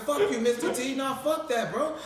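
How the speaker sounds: high, amused fake bravado; laughing tough-guy bluff; laughing mock defiance. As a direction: brush him off while laughing.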